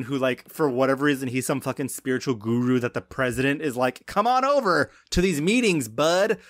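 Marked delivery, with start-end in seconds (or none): 4.1-6.4 higher, chummy mock invite; mock buddy voice. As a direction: slap him on the back. Mocking the president's invitation.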